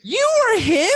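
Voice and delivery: high, loud astonished shout; stunned realization, shouting; shocked. Real, not acted. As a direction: yell it in total disbelief. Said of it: "shocked discovery".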